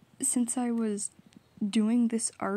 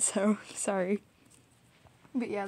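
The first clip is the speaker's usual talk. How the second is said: ordinary delivery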